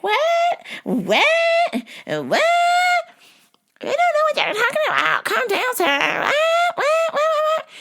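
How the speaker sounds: high, baffled lady voice; confused lady voice. Retold or imitated. FedEx lady answering the yelling man, high baffled voice. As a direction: play a flustered woman who doesn't get it. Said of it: "the lady was just like".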